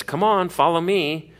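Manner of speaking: lightly acted stranger urging them along; coaxing stranger. Stranger calling sheep to follow him, coaxing. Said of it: "If stranger says".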